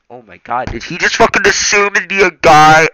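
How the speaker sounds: very loud, high incredulous yell; real outburst. Real, not acted. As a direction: burst out in outraged disbelief. Reader's shocked aside at text.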